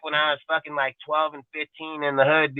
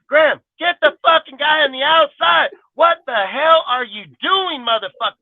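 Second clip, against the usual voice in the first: high, exasperated shout at a player; angry fan yell; 12 semitones higher than usual. Retold or imitated. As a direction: bawl him out from the stands.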